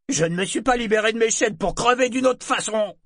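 loud, high defiant shout. A narrator's reading. Freed slave refusing to risk his life, loud retort. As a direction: shout down the order. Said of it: "déclara un esclave d'une voix forte".

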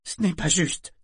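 high, loud choked protest; strangled voice. A narrator's reading. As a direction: protest as if choking on it. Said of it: "d'une voix étranglée". (d'une voix étranglée)

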